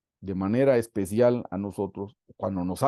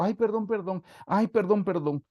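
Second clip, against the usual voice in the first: high, whiny mock apology; lightly whined plea; mock begging; 9 semitones higher than usual. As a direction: parody a husband pleading sorry. Mock repentant husband.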